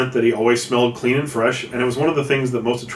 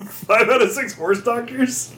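incredulous; 11 semitones higher, slower than usual. Real, not acted. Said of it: "incredulous repeat".

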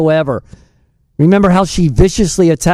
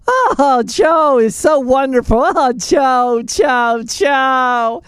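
higher, gushing mock swoon over Joe; mocking Kamala; 5 semitones higher, slower than usual. Host mocking Kamala Harris gushing over Joe, higher. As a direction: mimic her gushing about Joe, higher. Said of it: "she'd be going on and on".

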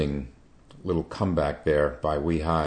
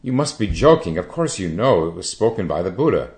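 louder and higher, an offended protest; indignant protest; 3 semitones higher, 6 dB louder than usual. Acted. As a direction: protest as if the question were an insult. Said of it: "the monks answered indignantly".

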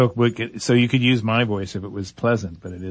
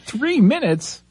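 surprised; much slower than usual. Real, not acted. Cohost startled by time cue.

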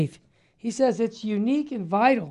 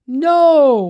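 emphatic No; 7 semitones higher, 9 dB louder than usual. Real, not acted. Preacher rejecting worldly church.

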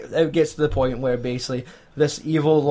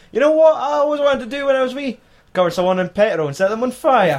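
much higher, fast, hammed-up creepy glee; mock gleeful villain; 9 semitones higher, 4 dB louder than usual. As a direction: play the killer relishing his wish. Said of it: "he's like".

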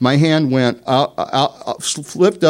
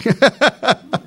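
embarrassed laugh; 11 semitones higher, 4 dB louder than usual. Real, not acted. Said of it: "what am I saying".

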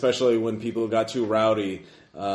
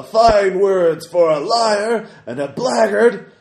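booming, higher, slow roar; booming arrogant alien overlord; 11 semitones higher, 10 dB louder, slower than usual. Acted. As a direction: roar it from the crowd like a giant. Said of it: "booming voice roars".

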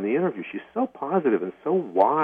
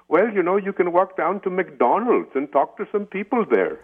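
put-on light Indian accent, high and laughing; 10 semitones higher, 3 dB louder than usual. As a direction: imitate an Indian lady's accent, amused. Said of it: "she had this very strong Indian accent".

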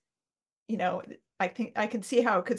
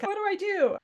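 mock-panicked, much higher; imitating a flustered mom; 11 semitones higher, faster than usual. Young mom asking for help with her toddler, mock-panicked high voice. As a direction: throw the question up high like a frazzled mom. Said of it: "And she's like".

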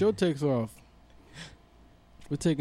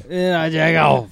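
slow, louder mock rap line; 3 semitones higher, 9 dB louder than usual. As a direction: lean on it like a hammed-up rapper. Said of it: "like T I said".

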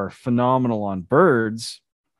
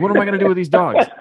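high, loud and fast; hammed-up disbelief, rushed; mock exasperation; 8 semitones higher, 5 dB louder, much faster than usual.

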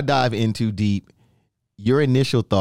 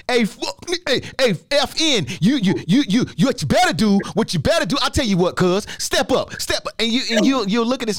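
angry yelling; 11 semitones higher than usual. Retold or imitated. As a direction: go off on him, angry. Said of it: "and I get mad".

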